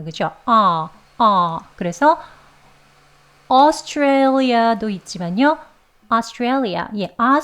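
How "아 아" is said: 아 said slowly, mouth wide; 아 held long and open; 아 stretched vertically; open vowel demo. Shown as a demonstration. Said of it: "소리는 아라고 냅니다".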